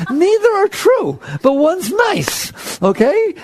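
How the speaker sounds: high, slow and insistent; emphatic point. Real, not acted. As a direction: stretch it out high to make the point.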